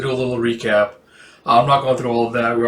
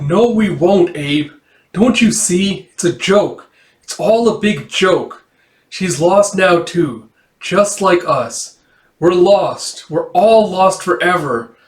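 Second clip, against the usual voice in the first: high, raised cry of hopelessness; tearful despair; 5 semitones higher, 4 dB louder, slower than usual. Acted. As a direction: act out a distraught, bitter breakdown. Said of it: "tears streaming out of her eyes".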